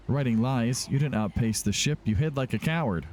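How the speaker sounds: usual speaking voice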